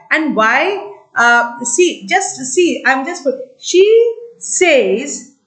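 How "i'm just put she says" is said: usual speaking voice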